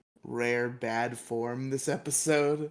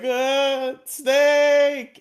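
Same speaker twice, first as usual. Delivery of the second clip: high, loud, drawn-out scream of fear; shrieking scream; 12 semitones higher, 9 dB louder than usual. Retold or imitated. Zack shrieking at the snake in mock terror. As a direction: shriek it like a terrified kid. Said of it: "Zack's like".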